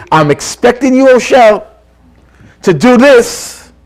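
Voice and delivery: firm, high-pitched insistence; heavy demand. Acted. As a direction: lay down a pointed expectation, drawing out the last words. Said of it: "heavier demand".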